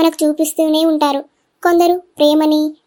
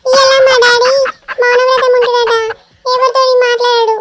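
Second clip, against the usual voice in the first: put-on higher, louder little boy asking; the little son teasing his dad, higher and louder; child's voice; 6 semitones higher, 3 dB louder than usual. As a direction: play the cheeky young son. Actor voices the small son.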